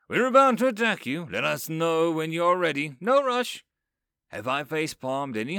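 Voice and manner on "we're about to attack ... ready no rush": loud, high booming proclamation; queen's magically amplified voice, loud and cheerful; amplified announcement. A narrator's reading. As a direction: call it out across the battlefield. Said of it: "her voice is now amplified a hundredfold".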